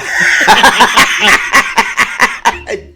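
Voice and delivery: loud, high-pitched laughing fit; helpless laughter. Real, not acted. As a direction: crack up completely. Cracking up at the mispronounced crocodile.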